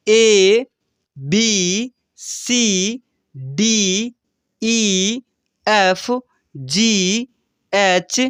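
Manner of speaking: letters called out slowly for the class to repeat; teacher leading the class in repeating the alphabet; call-and-repeat recitation. Shown as a demonstration. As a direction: drill each letter so students can echo it. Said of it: "मेरे साथ-साथ आप लोग बोलना start कीजिए".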